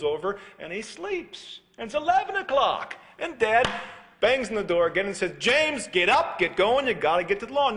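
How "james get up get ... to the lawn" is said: raised, high scolding voice; impatient dad. Retold or imitated. Dad banging on the door, loud and high. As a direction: hurry the lazy son up, loudly.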